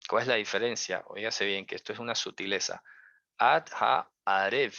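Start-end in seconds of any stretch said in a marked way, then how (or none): 3.4-4.7 Ad Ha'arev slowly, leaning on the E of Ha'arev; stress on the E. Shown as a demonstration. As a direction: draw out Ad Ha'arev with the accent on the E. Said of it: "con la tilde en la E".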